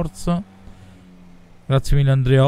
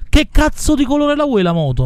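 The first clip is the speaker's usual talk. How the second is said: higher, exasperated cursing question; 8 semitones higher than usual. Real, not acted. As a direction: demand an answer, fed up. Asks third time, exasperated.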